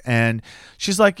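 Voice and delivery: ordinary delivery, nothing marked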